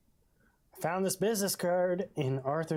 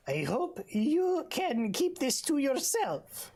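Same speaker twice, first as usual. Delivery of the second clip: much higher, slow put-on accent; whisper-like plea in a squeaky accent; Bull's accent; 10 semitones higher, slower than usual. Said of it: "and he finally said".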